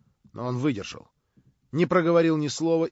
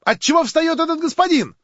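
high, loud student's outcry; shouted question; 14 semitones higher, 9 dB louder than usual. A narrator's reading. Girl student challenges a man getting up, shouting shrilly high. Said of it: "крикнула студентка".

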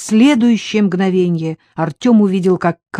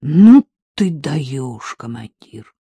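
genuine delight; 4 semitones lower, 4 dB louder, much slower than usual. A narrator's reading. Co-pilot catching his breath after the fight, slow admiring delight. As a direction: marvel slowly, then drop away on the final word. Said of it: "проговорил с неподдельным восторгом".

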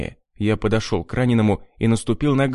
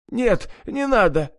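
high, slow terrified plea; desperate pleading; 11 semitones higher than usual. A narrator's reading. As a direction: beg for mercy. Begging not to be cut. (испуганная мольба)

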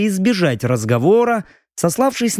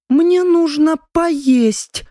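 high voiced character; 12 semitones higher, much slower than usual. Hungry young man asking food.

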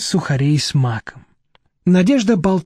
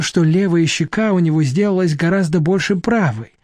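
plain reading voice

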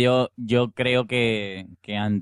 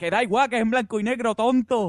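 silly high voice; 11 semitones higher than usual. Acted. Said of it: "Y dice".

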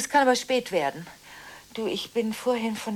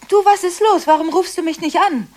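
high, loud excited hello; excited greeting; 8 semitones higher, 10 dB louder than usual. Acted. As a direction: greet a friend gushingly. Friend answers phone, high.

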